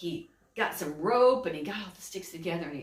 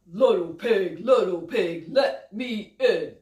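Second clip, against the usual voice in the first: louder, slow wolf voice; 6 dB louder, slower than usual. Acted. Wolf demanding entry at the pig's door, louder. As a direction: growl it out like the wolf. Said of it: "the wolf comes up".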